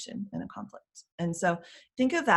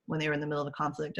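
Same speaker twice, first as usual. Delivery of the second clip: normal talking voice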